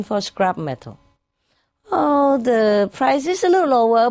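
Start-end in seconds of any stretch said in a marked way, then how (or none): 1.9-4.1 high, louder gleeful remark; lightly voiced hopeful trader; pleased surprise. Businessman musing at the market.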